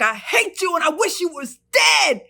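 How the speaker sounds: high, loud hateful shout; furious shout. Retold or imitated. Wife screaming at husband, high loud shout. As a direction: scream it with full hatred. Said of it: "I was like".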